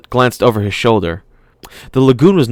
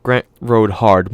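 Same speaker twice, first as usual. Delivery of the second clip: usual speaking voice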